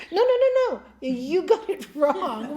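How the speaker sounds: a monk yelling that heaven erred; monk protesting to heaven, loud and high; panicked protest. Acted. Monk protests dark carriage.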